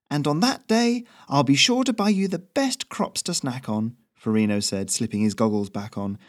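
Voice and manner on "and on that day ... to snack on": high, easygoing chatty messenger; breezy reassurance, pitched up; Ferrino's voice. Acted. Messenger reassures the nervous local, high and easy.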